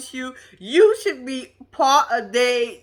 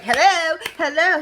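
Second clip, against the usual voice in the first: mock call to rouse someone, a little raised; 5 semitones higher than usual. Woman calling to partner fake sleeping, retold call.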